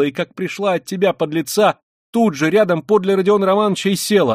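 high, loud scolding tirade; furious Katerina Ivanovna. Acted. Katerina Ivanovna turning on the landlady and Luzhin, high fury. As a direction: berate them all indignantly.

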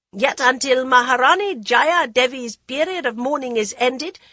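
put-on loud, commanding old queen; authoritative dowager queen. A narrator's reading. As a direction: address the council with royal authority. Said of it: "strong with authority".

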